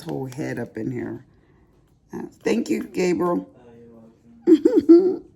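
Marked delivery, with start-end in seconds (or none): 4.5-5.2 high, loud laughing you're welcome; giggly high reply to the grandson. Real, not acted. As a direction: answer through a burst of laughter.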